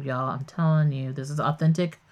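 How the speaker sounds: ordinary talk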